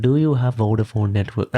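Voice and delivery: lightly put-on Indian accent. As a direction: ask it in a playful Indian accent. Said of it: "whole podcast in Indian accent".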